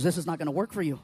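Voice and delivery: low doctor's verdict. Acted. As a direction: pronounce it deep, as the doctor. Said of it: "doctor walks in".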